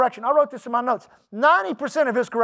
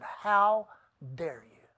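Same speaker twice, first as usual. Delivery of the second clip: slow, hushed stern rebuke, dropping away; low, quiet reproach with a pause before "dare"; measured reproof, sinking very soft at the end; God's rebuke; 4 semitones lower, 7 dB quieter, much slower than usual. Retold or imitated. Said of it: "That's how he said it".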